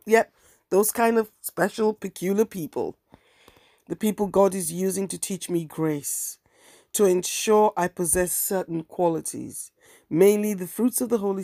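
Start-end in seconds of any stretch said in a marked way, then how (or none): none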